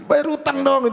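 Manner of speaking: high-pitched mock nagging; retold grumbling. Lender grumbles behind debtor's back, high mock nagging. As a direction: imitate a lender griping behind someone's back. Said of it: "ngomel di belakang aja".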